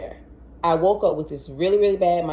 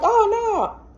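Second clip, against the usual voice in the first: very high "oh, no"; dismayed. Retold or imitated. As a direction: squeal in alarm. Dismayed at client's wife news.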